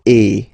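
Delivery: loud short eh; French e sound. Shown as a demonstration. Teacher showing the other tréma sound. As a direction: say e plainly. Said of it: "it can be pronounced like".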